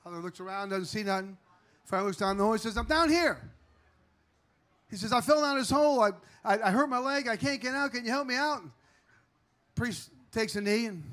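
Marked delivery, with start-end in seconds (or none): 2.8-3.4 calling up. Acted. Calls up to priest. 5.1-8.6 pleading. Acted. Trapped man pleads with priest.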